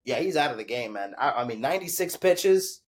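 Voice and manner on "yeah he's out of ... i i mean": ordinary talk, nothing marked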